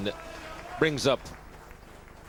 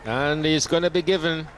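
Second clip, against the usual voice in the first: raised, louder wicket call; excited commentary call; 8 semitones higher, 5 dB louder than usual. Real, not acted. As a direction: sell the moment to the listeners.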